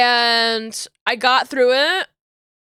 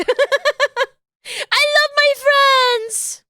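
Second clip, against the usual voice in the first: high, slow crying wail of love; teary sobbing; 13 semitones higher, 3 dB louder, much slower than usual. Real, not acted. Crying to viewers about loving her friends. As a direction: sob it out, overwhelmed with love. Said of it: "I'm getting, like, teary-eyed".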